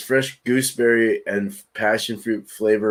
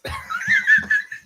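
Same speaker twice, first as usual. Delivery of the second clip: high squealing burst of laughter. Real, not acted. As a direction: shriek with laughter. Friend gulps beer on stream.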